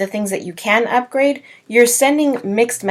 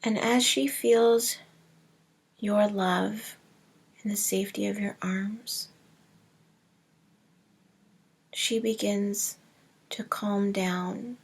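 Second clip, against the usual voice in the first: soft, hushed meditation voice with long pauses; hushed, unhurried visualization, fading to near whisper; guided meditation; 9 dB quieter than usual. Acted. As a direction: guide them gently inward, growing quieter and slower. Leads a breathing meditation.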